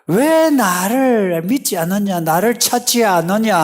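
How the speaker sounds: high, loud pleading rebuke; frustrated reproach. Acted. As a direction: demand an answer as a frustrated God. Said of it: "하나님의 그 답답함".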